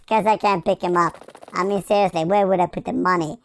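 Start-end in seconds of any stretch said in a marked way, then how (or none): none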